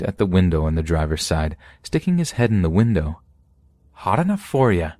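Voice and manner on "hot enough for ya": higher-pitched, uncontrolled greeting from a creepy man; lightly voiced man, pitched up and unguarded. A narrator's reading. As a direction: voice a creepy man no longer holding back, lightly acted. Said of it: "not worried about controlling his voice".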